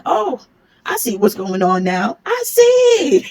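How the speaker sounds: high, drawn-out knowing "I see"; a sly coworker catching on, teasing; mocking coworker. New guy voiced, mocking realization.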